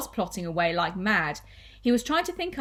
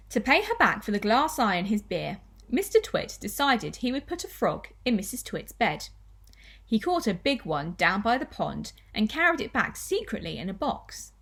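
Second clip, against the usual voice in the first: ordinary delivery, nothing marked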